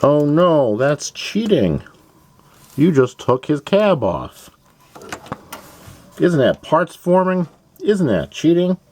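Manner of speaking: high-pitched, drawn-out fake dismay; mock objecting viewer. Imagined fan protesting the removed cab, high mock alarm. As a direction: whine in high mock outrage.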